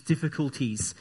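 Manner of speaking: ordinary talk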